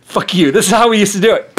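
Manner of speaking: loud, fast, defiant retort; defiant outburst. Retold or imitated. Old man Rothschild telling off the accuser, loud and fast. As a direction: snap it back proudly and fast. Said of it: "and be like".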